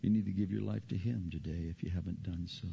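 usual speaking voice

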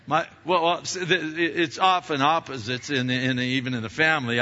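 ordinary talk, nothing marked

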